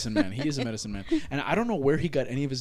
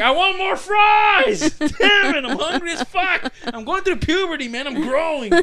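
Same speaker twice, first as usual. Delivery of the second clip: high, louder, slow hammed-up shout; mock teen yell; 14 semitones higher, 7 dB louder, slower than usual. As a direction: yell it like a starving teenager. Retold teen demanding more fries.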